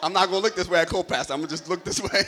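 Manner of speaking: loud, amused laughing speech. Real, not acted. Preacher teases about co-pastor mid-sermon, laughing. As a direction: laugh through the joke. Joking, avoids looking at co-pastor.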